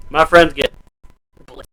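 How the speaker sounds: ordinary talk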